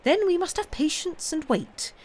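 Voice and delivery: lightly voiced resolute old nurse; Miss Pross voice.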